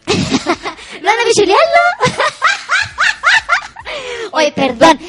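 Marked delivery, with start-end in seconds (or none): 1.9-4.3 long, high-pitched cackle; real helpless high laugh; laughing like Natita. Real, not acted. Host cracking up at her co-host's question, high cackle. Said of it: "a veces me río como la Natita".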